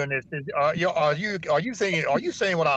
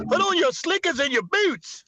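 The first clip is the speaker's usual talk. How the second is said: high, hyped-up rally MC; rally opener hype; 10 semitones higher than usual. Pretend. Opening the Trump rally for the crowd, high hype MC. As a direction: work the crowd like a warm-up act. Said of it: "let the rhino fly out to Arizona".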